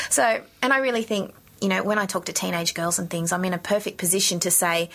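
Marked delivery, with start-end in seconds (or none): none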